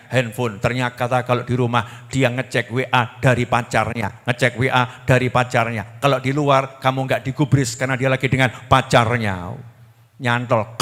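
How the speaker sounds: ordinary delivery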